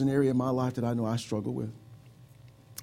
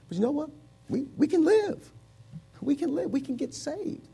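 soaring hope; 13 semitones higher than usual. Real, not acted. Preacher to congregation, rising high with joyful hope. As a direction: voice leaps high with sudden hope.

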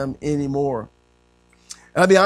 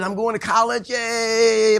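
mock excitement; 6 semitones higher, slower than usual. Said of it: "I was all excited".

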